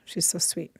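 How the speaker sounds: soft whispered fondness; tender whisper. Real, not acted. Teacher fondly recalling her friend Cláudia, whispered. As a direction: breathe it out fondly.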